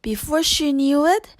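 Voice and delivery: usual speaking voice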